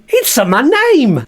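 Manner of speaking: high, slow, insistent puppet voice; Bert voice. Acted. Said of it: "No, it's Bert".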